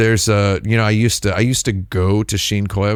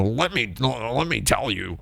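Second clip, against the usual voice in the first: softer, put-on cartoon duck voice; Donald Duck; 2 semitones higher, 7 dB quieter than usual. Explainer softening his corrections with a put-on cartoon duck voice. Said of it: "putting on my Donald Duck voice".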